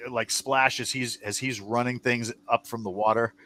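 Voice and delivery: normal talking voice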